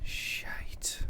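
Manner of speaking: whispered swear of dismay; real, hushed reaction to bad news; whispered curse. Real, not acted. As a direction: curse under your breath. Player reacts to sudden fire blast.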